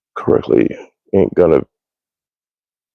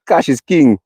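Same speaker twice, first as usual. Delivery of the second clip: imitated Jamaican accent, pitched up; 6 semitones higher than usual. Quoting a guy he knew, in a Jamaican accent. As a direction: quote him in a put-on Jamaican accent. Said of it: "It's with an accent".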